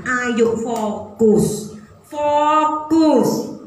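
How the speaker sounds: very slow, stretched "Ayo fokus"; drawn-out exhortation. Acted. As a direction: urge the congregation to focus. Preacher's exhortation.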